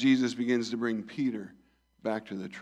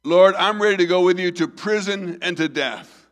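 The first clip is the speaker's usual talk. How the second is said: loud, higher, fervent vow; passionate exclamation; 6 semitones higher, 10 dB louder than usual. Acted. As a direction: throw it out like a man swearing loyalty. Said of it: "Peter exclaimed".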